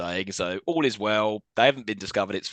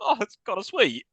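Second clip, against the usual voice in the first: touched; 9 semitones higher than usual. Retold or imitated. Retelling own touched reaction.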